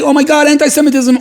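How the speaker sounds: high-pitched mock panic; mock alarm. Someone reacting to news of anti-Semitism, mock alarm. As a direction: exclaim it in exaggerated dismay.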